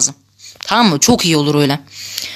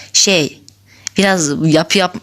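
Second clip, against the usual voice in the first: normal talking voice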